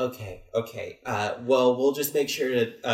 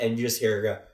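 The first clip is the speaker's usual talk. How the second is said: ordinary talk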